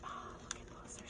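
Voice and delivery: soft amazed whisper. Real, not acted. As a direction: whisper it in awe. Opening package, hushed awe.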